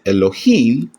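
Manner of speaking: Elohim with the stress on the last syllable, him; Elohim, landing firmly on its ending; stress on final syllable. Shown as a demonstration. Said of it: "here's the accent".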